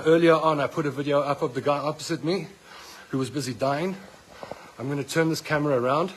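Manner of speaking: normal talking voice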